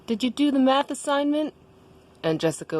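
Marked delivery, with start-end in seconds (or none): 0.1-1.5 high-pitched questioning character voice; Daniel. Acted. Daniel asks Jessica about math homework, in a high voice. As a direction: ask it in a raised, put-on voice. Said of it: "And Daniel was like".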